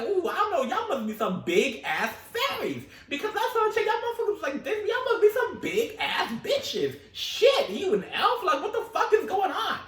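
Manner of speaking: high, loud, laughing mock scolding; laughing disbelief. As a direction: laugh through it, half-scolding the fairies. Mock talking to fairies.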